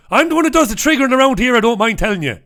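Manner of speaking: high, fast, loud put-on Irish-tinged accent; quick, high-pitched mimic of Hayden's accent. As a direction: rattle it off in Hayden's brogue. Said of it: "That's what he says in, in that accent".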